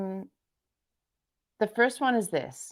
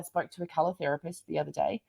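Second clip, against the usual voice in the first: ordinary delivery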